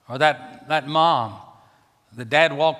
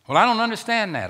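objecting listener; 10 semitones higher, 4 dB louder than usual. Retold or imitated. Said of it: "objecting listener voiced".

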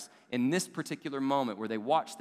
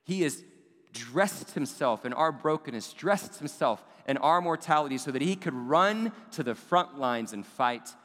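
higher and louder, full-force sermon build; preaching climax; 4 semitones higher, 4 dB louder than usual. Acted. Preacher proclaiming Advent to congregation, rising and forceful. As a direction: drive it home to the congregation.

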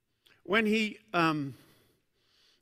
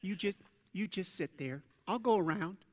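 soft gentle driver; 6 dB quieter than usual. Retold or imitated. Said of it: "and they're like".

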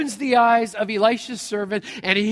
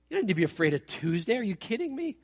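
normal talking voice

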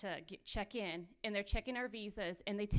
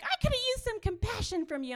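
friend's complaint; 13 semitones higher, 7 dB louder than usual. Retold or imitated. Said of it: "she's just like".

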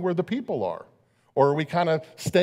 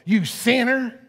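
sharp, high, scornful jab of "You sinner."; a preacher mocking the wrong way to greet a stranger; mock accusation; 7 semitones higher, slower than usual. Mock accuser snapping at a lady on the beach. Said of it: "go like".

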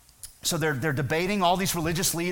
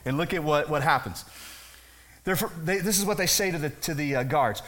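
ordinary talk, nothing marked

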